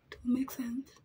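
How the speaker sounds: soft whispered aside; whispering. Real, not acted. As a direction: whisper it to yourself. Whispered aside while doing makeup.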